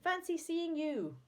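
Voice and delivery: high, amazed, delighted greeting; yelling. A narrator's reading. Amazed man greeting his old friend, yelling. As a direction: yell a welcome, stretching it at the end. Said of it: "he yelled".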